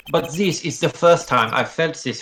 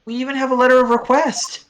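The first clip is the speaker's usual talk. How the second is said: put-on higher, stammering girl voice; flustered girl; 12 semitones higher, 4 dB louder than usual. Girl stammers to the charming stranger, higher and nervous. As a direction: play her nervous and high.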